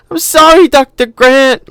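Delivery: put-on high, loud, slow wailing girl; girl wailing sorry to Doctor Grant. A narrator's reading. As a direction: wail the apology like a frightened child. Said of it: "Lex wailed".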